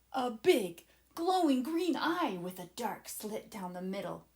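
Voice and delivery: plain reading voice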